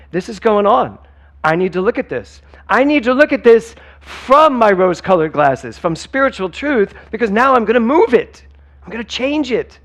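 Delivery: high, loud, determined inner voice; empowered self-talk. Acted. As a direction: pump yourself up, high and loud.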